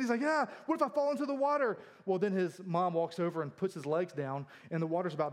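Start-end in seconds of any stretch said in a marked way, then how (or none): 0.2-1.8 higher-pitched imitation of a panicking child; mock freak-out. Preacher imitating a three-year-old hanging over water, mock panic. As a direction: playfully imitate a kid in a panic. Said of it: "he's, like, freaking out".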